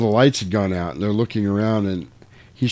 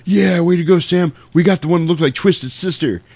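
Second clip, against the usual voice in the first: higher congratulating voice; mock excited brothers; 6 semitones higher than usual. Frog Brothers congratulate Sam upstairs, excited. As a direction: cheer him on as a boastful buddy. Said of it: "he's like".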